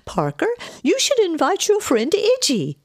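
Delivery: very high, swooping suggestion; fluttery aunt. A narrator's reading. Aunt suggesting a guest, high and fluttery. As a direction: pipe it up high, saying "Iggy" confidently. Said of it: "Edith's mispronunciation".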